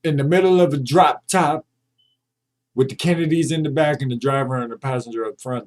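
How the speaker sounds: loud, worked-up rant about the seat; heated rant. Real, not acted. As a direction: push it out like you're fed up and sure you're right. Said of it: "that's a rant I had".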